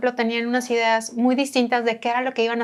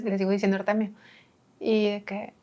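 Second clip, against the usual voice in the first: ordinary talk, nothing marked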